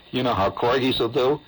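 usual speaking voice

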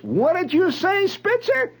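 acted-out barracks challenger; mock challenge. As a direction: call him out in a high, pushy voice. Retelling, acts out a challenger.

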